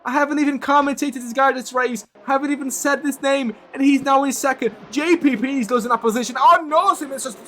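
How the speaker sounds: rising, breathless race call; excited commentary. Real, not acted. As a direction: call the chaos at full pitch. Live race crash commentary.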